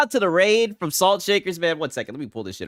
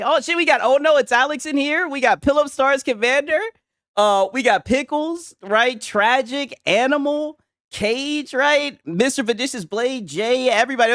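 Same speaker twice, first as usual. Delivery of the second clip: hyped up; 6 semitones higher than usual. Real, not acted. Hype shoutouts to viewers.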